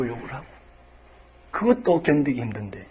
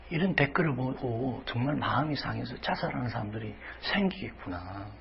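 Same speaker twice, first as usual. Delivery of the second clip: whisper; 9 dB quieter than usual. Retold or imitated. Preacher voicing inner realization.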